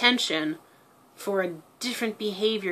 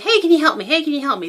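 pestering plea; 7 semitones higher, 7 dB louder, faster than usual. Retold or imitated. Pestering friend repeatedly.

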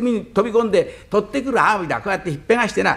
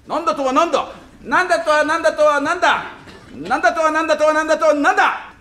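high, chanted comic indignation; mock-angry, high-pitched bluster; blustering retort; 7 semitones higher than usual. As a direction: fire back a growing tongue-tangling challenge. Escalating acted quarrel at the door.

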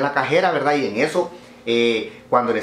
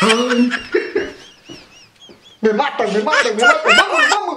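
high, loud laughing exclamations; laughing hard; 10 semitones higher, 4 dB louder than usual. Real, not acted. Teller cracking up at own punchline, loud high laughter. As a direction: cry it out through laughter.

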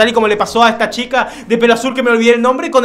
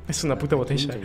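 low, much softer remark; unimpressed aside; 7 semitones lower, 12 dB quieter than usual. Real, not acted. Gamer commenting aside on the bottle, quietly unimpressed. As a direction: say it low and under the voice.

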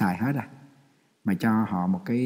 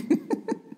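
breathy laugh; 4 dB quieter than usual. Real, not acted. Laughs after the nun story.

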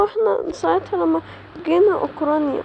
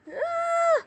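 very high, soft pained scream; 11 semitones higher, 6 dB quieter, much slower than usual. Acted. The crowd chases her, she screams high. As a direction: cry out in fear.

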